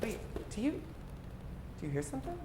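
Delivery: soft, secretive listening voice; mock suspense whisper; hushed suspense. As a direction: hush and listen for a sound. Hearing something in soil.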